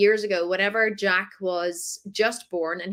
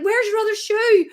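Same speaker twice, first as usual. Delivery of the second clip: higher, fed-up "where's" question; mock-exasperated mum chasing a lost shoe; exasperated parent; 12 semitones higher, 5 dB louder than usual. Mum to toddler who lost a shoe, exasperated. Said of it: "and you're like".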